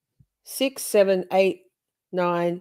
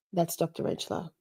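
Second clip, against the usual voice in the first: breathy hushed intro; 10 dB quieter than usual. Shown as a demonstration. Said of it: "And this is Doctor Rentschler".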